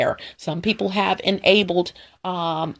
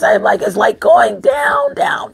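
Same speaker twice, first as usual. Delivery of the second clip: voice cracking; 8 semitones higher, 6 dB louder than usual. Real, not acted. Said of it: "What is going on with my voice".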